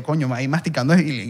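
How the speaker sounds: ordinary talk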